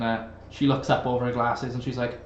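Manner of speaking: normal talking voice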